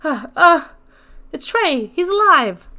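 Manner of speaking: loud, high startled joy; thrilled disbelief; excited surprise. Acted. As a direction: gasp then cheer that he lives. Excited recognition of survivor.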